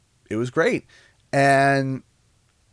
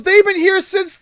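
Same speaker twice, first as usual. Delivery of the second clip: store manager; 6 dB louder than usual. Retold or imitated. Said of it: "And the manager goes".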